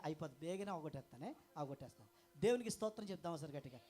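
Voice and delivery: ordinary delivery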